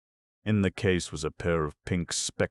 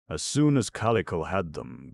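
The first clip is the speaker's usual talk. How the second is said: ordinary delivery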